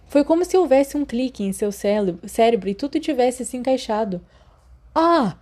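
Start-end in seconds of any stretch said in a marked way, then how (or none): 4.9-5.4 high, loud "Ah!" of discovery; excited exclamation. Acted. Remus jumping up at his sudden idea, excited. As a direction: leap up with the idea. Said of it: "ele saltou".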